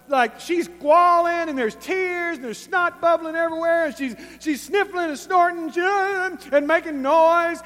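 high, worked-up retelling of the woman's noisy crying; amused preacher acting out a noisy scene; excited mock description. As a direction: paint the mess with wide-eyed animation. Mocking the weeping woman's noisy scene.

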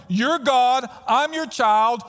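pleading with God, voice climbing and swelling; pleading outcry. Acted. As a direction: cry out to God, building to a peak on why. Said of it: "Here's the argument".

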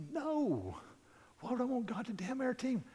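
high-pitched, incredulous protest; startled objection. Retold or imitated. Swearing man's startled, indignant reply.

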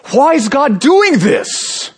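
high, slow, whining "Why is God doing this?"; preacher mimics an indignant believer, high and slow; mock angry whine. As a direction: drag it out like a sulking complainer. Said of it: "when we angrily say".

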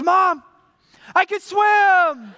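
put-on loud, high, drawn-out child's cry; triumphant shout. Daughter telling mom she can swim, slow triumphant shout.

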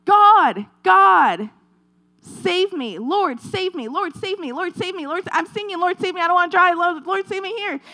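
high, desperate cries for rescue; mock panicked pleading to God, high and urgent; crying out for help. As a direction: plead over and over like a drowning man. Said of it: "he's crying out".

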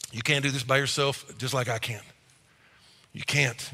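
ordinary talk

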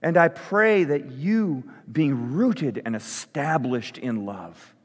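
slow, deliberate prayer with long pauses; slow spoken prayer. Real, not acted. Pastor praying Paul's words over the church, slow prayer. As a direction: pray it slowly, leaning on the key words. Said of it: "I'm gonna say it slowly".

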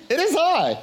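high, hammed-up grand announcement; pompous high-pitched scriptural parody; King James style. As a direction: proclaim it in a mock-lofty high voice. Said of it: "Jesus didn't speak King James".

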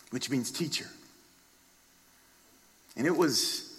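ordinary talk

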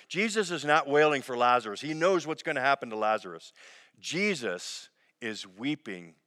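normal talking voice

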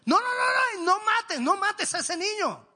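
high, loud cry of no, no, no; Jesus shouting from heaven to stop Abraham, high and crying; desperate pleading shout. Acted. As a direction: scream to stop the knife, near tears. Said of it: "le está diciendo".

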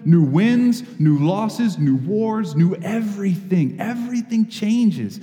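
preaching crescendo. Acted. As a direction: build the list like a revival preacher. Builds to a preaching climax.

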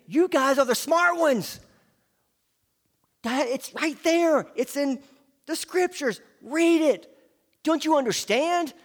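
Jesus rebuking. Retold or imitated. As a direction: press the leaders hard.

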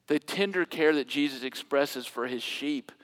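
ordinary talk, nothing marked

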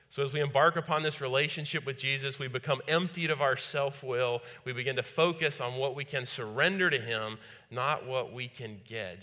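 loud, driving sermon voice; fervent preaching. Acted. Preaching at full force.

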